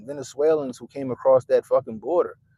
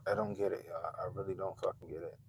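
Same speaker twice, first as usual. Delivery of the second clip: normal talking voice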